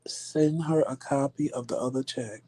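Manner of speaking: unvoiced whispered aside; hushed whispered instruction. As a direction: whisper it to him. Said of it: "I'm like".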